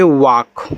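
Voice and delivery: वॉक, long vowel straight into k; silent L. Shown as a demonstration. Teacher reads the word for learners, silent L in वॉक. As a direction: say वॉक with no l before the k. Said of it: "यहाँ भी आपका यल silent रहेगा".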